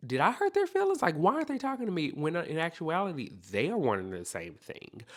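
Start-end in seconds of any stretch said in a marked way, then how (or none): 0.1-2.1 high, loud, rushed worried questions; worried wondering. Acted. Someone wondering if they hurt another's feelings, anxious and high. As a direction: fret it out fast and high-pitched.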